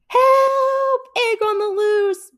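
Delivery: high, loud panicked yell; shout for help. A narrator's reading. Bear calling for help as egg escapes, panicked yell.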